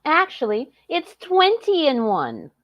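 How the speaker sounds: high, slow pitchman mockery; infomercial voice. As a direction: sell it like a late-night ad. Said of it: "this bitch thinks she's on an infomercial".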